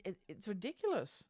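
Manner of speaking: ordinary delivery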